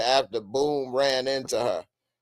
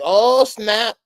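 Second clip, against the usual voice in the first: excited; 9 semitones higher, 8 dB louder than usual. Retold or imitated. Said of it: "I'm like".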